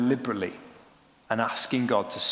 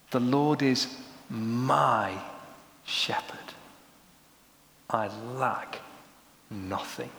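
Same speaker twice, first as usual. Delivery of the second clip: "my" drawn out between pauses, "shepherd" dropping to a hush; stress on MY; 4 dB quieter, much slower than usual. Shown as a demonstration. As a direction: linger on "my", then fall softer word by word. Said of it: "emphasize different words".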